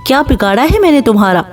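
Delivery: ordinary delivery, nothing marked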